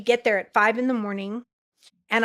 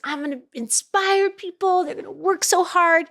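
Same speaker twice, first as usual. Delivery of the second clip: much higher, bright eager boast; excited gushing; 8 semitones higher than usual. Retold or imitated. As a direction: oversell your big dreams. Gushing young self retold.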